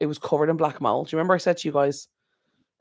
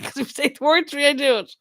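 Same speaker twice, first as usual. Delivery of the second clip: laughing; 12 semitones higher, 4 dB louder than usual. Real, not acted. Laughing while yawn talk.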